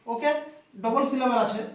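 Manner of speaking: usual speaking voice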